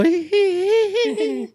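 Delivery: high-pitched villainous titter; squeaky "hee hee" behind the hand; covered-mouth snicker. Acted. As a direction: fake a sneaky little giggle. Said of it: "they cover their lips. They go".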